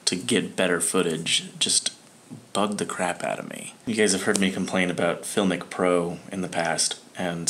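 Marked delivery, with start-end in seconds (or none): none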